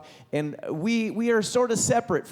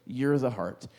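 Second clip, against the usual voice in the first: ordinary delivery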